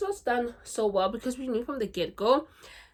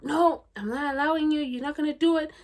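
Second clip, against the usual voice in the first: bossy boss quote; 6 semitones higher, 6 dB louder than usual. Retold or imitated. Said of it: "and he's like".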